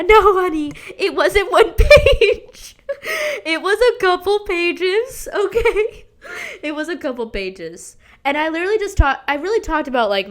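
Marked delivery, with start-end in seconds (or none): 0.0-2.8 laughing. Real, not acted. Telling fans about her letter. 3.5-6.1 laughing squeal. Real, not acted. Embarrassed confession to viewers.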